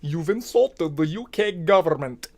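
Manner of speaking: put-on stern complaint; mock official. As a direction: play the official at the door, low and hushed. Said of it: "somebody's gonna come to my front door".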